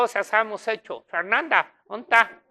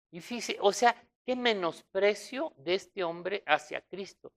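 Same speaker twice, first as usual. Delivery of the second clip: ordinary talk, nothing marked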